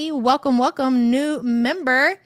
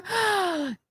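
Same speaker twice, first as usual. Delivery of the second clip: gasp; 7 semitones higher, 6 dB quieter than usual. Real, not acted. Shocked at viewer blocked.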